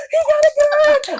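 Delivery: panicked cry. Retold or imitated. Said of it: "He's like".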